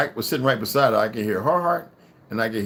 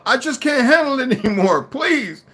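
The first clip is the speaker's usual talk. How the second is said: high, loud plea at the end of patience; exasperated plea; 13 semitones higher, 5 dB louder than usual. A narrator's reading. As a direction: plead loudly, voice pitched way up. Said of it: "he snaps at her to stop".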